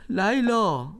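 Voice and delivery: loud call of her name. Acted. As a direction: call Leila from another room. Calls wife through the house. (صدا زدن بلند)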